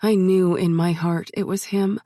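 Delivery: usual speaking voice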